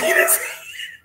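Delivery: high-pitched burst of laughter; laughing. Real, not acted. Woman laughing at his foolish mistake, high giggle. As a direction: crack up mid-story. Laughing at her own story.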